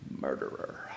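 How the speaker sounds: unvoiced mock accusation; hushed mock accusation; whisper. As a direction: breathe it like a guilty secret. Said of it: "whispered self-accusation".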